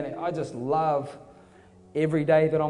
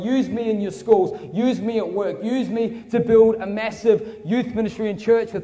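raised, driving appeal, hammering key words; preaching at full force; 6 semitones higher, 4 dB louder than usual. Real, not acted. Preacher urges the crowd to give God everything, full-force preaching. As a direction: build an impassioned altar call without letting up.

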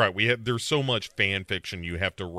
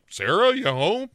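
lightly voiced higher call through the house; Cam; 9 semitones higher than usual. Boyfriend calling after Sarah, mildly raised calling voice. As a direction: call out, pitched up. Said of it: "she hears Cam's voice".

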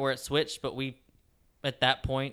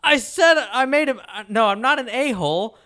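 higher, louder indignant protest; mocked defensive shout; 9 semitones higher, 7 dB louder than usual. Driver shouting back that he only made a mistake, indignant. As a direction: fire back defensively in a put-on yell. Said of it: "And he's like".

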